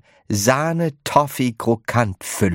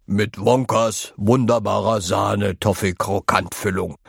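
put-on old grandpa calling out. A narrator's reading. Grandpa George praises the chocolate, calling out eagerly. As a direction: call it out like a keen grandpa. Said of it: "rief Grandpa George".

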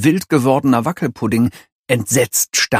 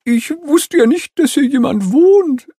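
nervous ostrich voice; 4 dB louder than usual. A narrator's reading. Ostrich character voice.

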